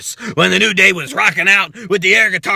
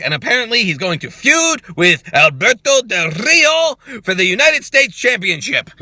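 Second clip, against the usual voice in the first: ordinary delivery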